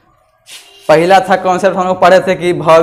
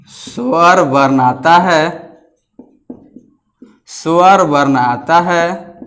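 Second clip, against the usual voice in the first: slow, spaced-out dictation pace with long pauses; slower than usual. Acted. Teacher dictating notes to his class, slow and deliberate. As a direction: read each phrase slowly so pupils can write it down.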